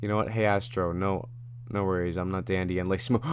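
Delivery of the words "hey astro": ordinary talk, nothing marked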